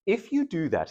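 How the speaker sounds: ordinary talk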